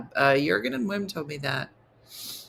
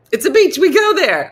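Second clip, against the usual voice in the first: higher, much louder, fed-up yell; exasperated shout; 10 semitones higher, 11 dB louder than usual. Pretend. Explaining to Jersey folks it's just a beach, shouting.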